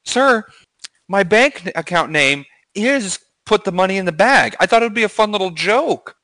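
high, sweet voice of an innocent robber. Pretend. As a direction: play it all innocent and high. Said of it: "and you're like".

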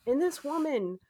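ordinary delivery

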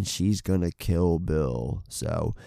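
ordinary talk, nothing marked

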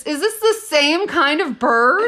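high, loud astonished question; shocked disbelief. Real, not acted. Viewer sees bird impale another bird, shocked disbelief. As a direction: ask it high and loud, aghast.

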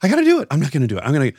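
wavering between yes and no, rising in panic; a child talking herself in and out of it; panicky self-talk. Retold or imitated. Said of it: "she's like".

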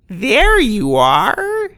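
high, drawn-out taunting greeting; sinister villain voice. Acted. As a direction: savor each word like a trap. Villain appears behind them.

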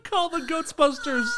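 laughing, slow and high; a laughing mock slogan.

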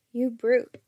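playful loud shout; gleeful shout. A narrator's reading. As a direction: shout it with glee. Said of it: "she shouted, gleefully pounding".

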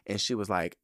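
usual speaking voice

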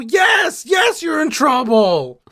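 high, shouted disgust at Ptolemy; outraged Caesar. Acted. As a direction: yell at him like a furious general. Caesar scolding.

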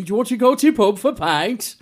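high-pitched northern English invitation; Yorkshire accent. Shown as a demonstration. Showing friends an English accent, high Yorkshire voice. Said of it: "I can give you a bit of that".

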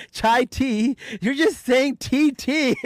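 high, mocking retort of a fussy commenter. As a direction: scoff at them like a know-it-all online. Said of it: "the chai tea comment, where it's like".

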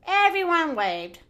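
usual speaking voice

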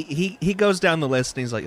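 normal talking voice

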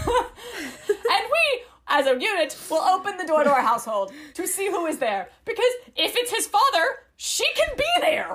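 high, laughing overacted plea; laughing mock plea. Pleading to stand together at the door, laughing. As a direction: laugh and wail it.

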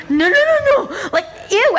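high, loud horrified no's; disgusted panic. Retold or imitated. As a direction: recoil in disgust. Getting spat on.

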